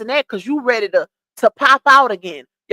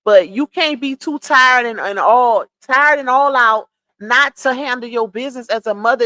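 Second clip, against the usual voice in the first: ordinary talk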